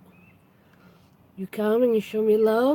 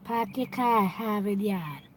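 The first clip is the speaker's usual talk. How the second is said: softer classic Boston line, pahk yah cah at Hahvid Yahd; Boston accent; 6 dB quieter than usual. Shown as a demonstration. Doing the famous Boston phrase for viewers. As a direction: drop every r, Boston style. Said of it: "I'll say it with the Boston accent".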